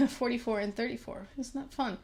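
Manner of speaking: ordinary talk, nothing marked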